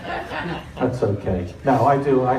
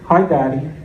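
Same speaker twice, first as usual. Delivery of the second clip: a hint of a wheedling daughter; lightly coaxing "Hi, Daddy"; mild mock sweetness to soften dad up; sweet-talking daughter. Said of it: "But this time she was like".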